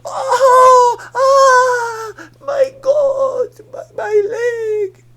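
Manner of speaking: high, loud, slow theatrical wail; hammed-up pain cry. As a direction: overplay the fall for sympathy. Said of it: "decided to milk it".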